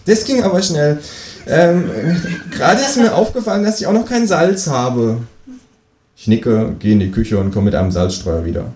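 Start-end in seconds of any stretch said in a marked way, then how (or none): 0.1-5.2 high-pitched kangaroo voice; surprised kangaroo. A narrator's reading. Kangaroo back to borrow salt, high voice. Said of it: "sagt das Känguru überrascht".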